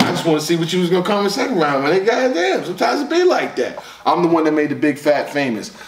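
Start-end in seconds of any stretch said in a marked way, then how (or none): 2.1-3.8 high, pleading exclamation; exasperated. Real, not acted. Reviewer excusing himself to the rapper, exasperated. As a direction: protest in a raised pitch. Mock apology after rapper's strong round.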